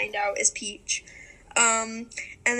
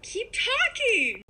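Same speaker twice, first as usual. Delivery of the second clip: playful; 10 semitones higher, much slower than usual. Real, not acted. Playful dodge while eating.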